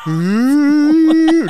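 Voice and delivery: very high, loud fake cry; mock wail. Comic breaking down over who he is, mock wail. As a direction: howl in hammed-up anguish.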